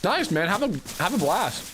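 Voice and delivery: talking with a mouth full of food. Real, not acted. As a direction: speak around a bite, mouth full. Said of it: "Sorry, I'm talking about my mouth full".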